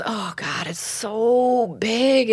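drawn-out, emphatic acted lament; overwhelmed exclamation. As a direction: act out her being overwhelmed, stretching the words. Voices overwhelmed woman in story.

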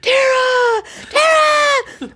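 very high, loud calling out; excited child calling. Retold or imitated. Boy calling Tara across the daycare, very high and loud. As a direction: shout her name across the room. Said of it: "he'd come pelting across the floor".

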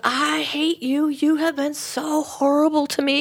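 high, drawn-out acted yell of anguish. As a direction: yell it as the wronged woman, stretching each accusation. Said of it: "And she yelled".

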